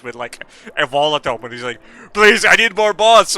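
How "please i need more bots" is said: loud, high, slow mock plea; mock begging. Mocking a streamer begging for bots, loud plea. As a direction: plead at full volume, hammed up.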